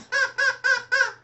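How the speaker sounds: very high squeaky puppet cackle; a bird puppet's shrill chuckle; high cartoon bird laugh; puppet squawk laugh.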